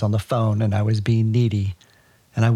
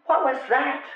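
much higher startled question; mock startled. Mother pretending to be spooked by her invisible son, much higher mock startle. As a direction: fake a jumpy fright. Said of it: "looking around startled".

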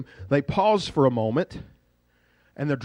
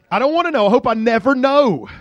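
high, loud cry of disgust; hammed-up horrified yell; squeamish outburst; 8 semitones higher, 7 dB louder than usual. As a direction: burst out in comic revulsion. Preacher recoils from imagined brain sound.

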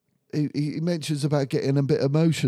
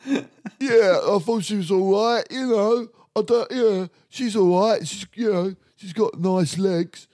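mocking lad contestant; 7 semitones higher, 5 dB louder than usual. Mocking a male contestant rating a girl.

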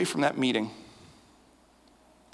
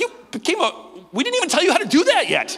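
much higher, louder astonished cry; stunned disbelief; 14 semitones higher, 4 dB louder than usual. Retold or imitated. Pastor reacting to Ren's conversion, high astonished cry. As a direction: blurt it out in shock.

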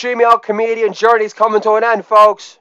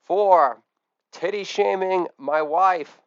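ordinary talk

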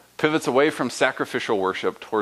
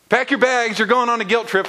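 higher, louder singsong tease; hammed-up mom warning aimed at God; mock guilt-trip call; 10 semitones higher, 3 dB louder than usual. Preacher applying mom's line to God, higher mock tease. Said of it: "my mom would often be in the background going".

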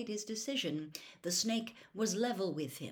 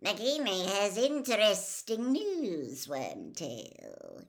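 cold voice; 3 dB louder, slower than usual. A narrator's reading. Cold master telling his servant Wormtail of news, icy and sinister. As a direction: address the servant with cold menace. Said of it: "the cold voice switched abruptly to English".

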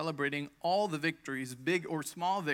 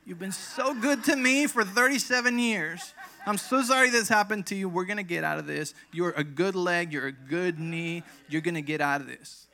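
higher, louder pep talk to a knee; emotional encouragement; 4 semitones higher, 10 dB louder than usual. Retold or imitated. Patient encouraging her injured leg, loud and emotional. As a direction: cheer the leg on with feeling. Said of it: "speak to it tenderly".

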